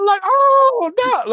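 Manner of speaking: high, loud wail of dismay; mock movie scream. Joking about people screaming at movies, loud mock wail. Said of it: "speaking of screaming into the movies".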